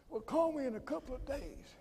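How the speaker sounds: imitating Sterling. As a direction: pitch way up and soft, mimicking the friend. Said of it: "It's the way he talked".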